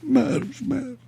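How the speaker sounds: very high, soft mock whimper; mock weepy echo. Host parroting a crying pundit, mock weepy. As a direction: trail the word off like a fake sob. Mocking the tearful clip.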